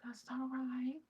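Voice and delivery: imitating a kind lady's high whisper; whispered reassurance. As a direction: hush and comfort the mother. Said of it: "She was like".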